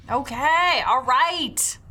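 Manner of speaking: high, called-back acknowledgement; exasperated daughter. Acted. Daughter agreeing to go to the christening, exasperated call. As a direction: give in to a nagging mom. Daughter answers nagging mother.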